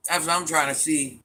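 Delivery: ordinary talk, nothing marked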